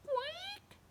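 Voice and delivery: very high squeak of a quack; tiny squeaky quack. Acted. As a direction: squeak it like a mouse. Mouse voiced.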